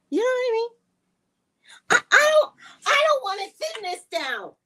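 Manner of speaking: high and loud, bursting with glee; excited high shout. Real, not acted. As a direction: squeal it out, thrilled. Excited shouting about plumpness.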